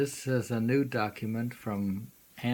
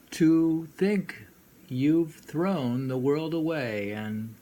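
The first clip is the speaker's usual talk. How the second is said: poem reading; 3 semitones higher, 5 dB louder than usual. Shown as a demonstration.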